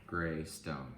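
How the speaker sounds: ordinary delivery, nothing marked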